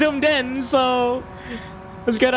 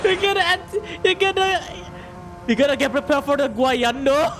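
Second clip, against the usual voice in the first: relieved laughter; 5 semitones higher than usual. Real, not acted. Still laughing after the scare.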